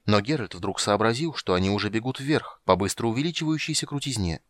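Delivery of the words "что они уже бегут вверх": plain reading voice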